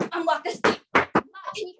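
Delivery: normal talking voice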